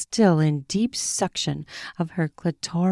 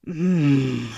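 low groan; 4 semitones lower than usual. Acted. Both groan out.

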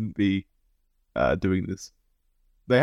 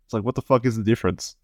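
normal talking voice

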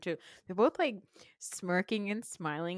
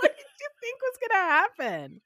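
high, louder mock disbelief; incredulous; 13 semitones higher, 6 dB louder than usual. Host mocking Sheree's surprise, high and incredulous. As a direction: ask it in high exasperated disbelief. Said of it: "I was like".

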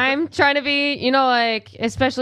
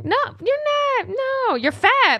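imitated reassurance; 11 semitones higher than usual. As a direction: imitate people rushing to deny it. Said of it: "it's literally people saying".

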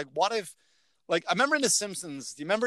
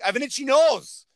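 plaintive complaint; 9 semitones higher, 6 dB louder than usual. Retold or imitated. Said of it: "I'm like".

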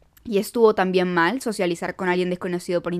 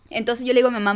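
ordinary delivery

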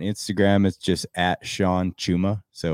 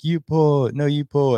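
much higher, louder bickering; freefall banter; 10 semitones higher, 4 dB louder, slower than usual. Retold or imitated. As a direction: argue in freefall over who pulls. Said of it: "they're like".

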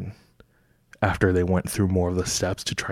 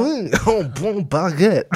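fake French accent; 10 semitones higher than usual. Continuing the French accent bit, high mock French. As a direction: ham up a cartoon Frenchman. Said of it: "What's your best French accent?".

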